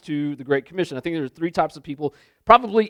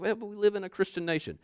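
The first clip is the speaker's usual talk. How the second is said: quick, soft protesting voice; lightly imitated naysayer; mock objection; 3 semitones higher, 10 dB quieter, faster than usual. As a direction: hurry out a small objection.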